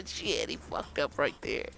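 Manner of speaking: ordinary talk, nothing marked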